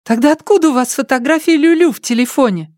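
put-on high, sharp girl's voice; demanding girl, pitched well up. A narrator's reading. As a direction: press him with a pointed, high-pitched question. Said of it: "Её голос прозвучал резко".

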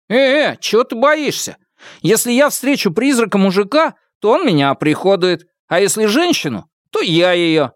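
high-pitched, cheeky bravado; high, playful swagger; cocky joking student. A narrator's reading. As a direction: brag to the roommate in a high put-on voice. Zhou Jun jokes crudely about ghosts.

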